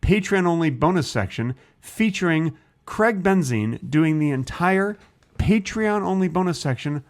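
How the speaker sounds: showy announcement, rising in pitch; mock announcer. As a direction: introduce it like a grand special feature. Mock announcing a bonus section.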